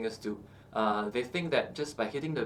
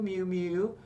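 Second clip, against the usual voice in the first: soft kitten-like mew, higher; 5 semitones higher than usual. Shown as a demonstration. Coach demos the mew drill, soft high mews. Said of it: "crying exercises".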